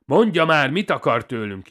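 high, loud insistent demand; slightly restrained shout. A narrator's reading. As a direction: repeat it urgently, barely reining it in. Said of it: "ezúttal valamivel halkabban".

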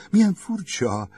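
high, loud exclamation of surprise; surprised cry. A narrator's reading. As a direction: gasp it out, caught off guard. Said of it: "kiáltott fel Eve". (meglepett, magas felkiáltás)

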